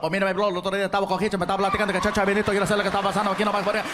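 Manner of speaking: breakneck Puerto Rican chatter; racing Puerto Rican Spanish. Shown as a demonstration. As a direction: talk as if against a time limit. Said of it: "like there's a time limit".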